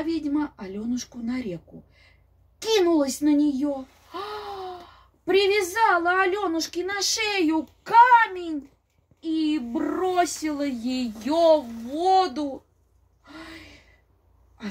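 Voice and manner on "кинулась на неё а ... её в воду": loud, rising dramatic storytelling; dramatic narration. A narrator's reading. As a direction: build the horror of the drowning. Witch drowns Alyonushka. (драматично, громко)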